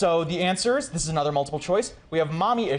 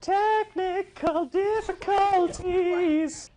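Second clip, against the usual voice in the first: very high, drawn-out silly announcer voice; mock announcement; much slower than usual. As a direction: stretch every syllable up high. Joking over a stalled reading.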